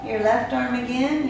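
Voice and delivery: ordinary talk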